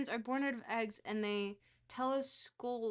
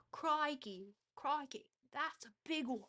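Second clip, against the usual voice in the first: higher-pitched stock Aussie accent; mock Australian accent; 5 semitones higher than usual. Shown as a demonstration. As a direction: play the Australian exclaiming over a find. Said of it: "start working on my accents again".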